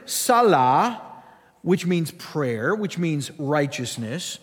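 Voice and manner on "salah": "Salah" said as sa-LAH; Arabic word. Shown as a demonstration. Teaching an Arabic word for prayer, "Salah" as sa-LAH. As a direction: open ah sounds in "Salah". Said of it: "There is an Arabic word".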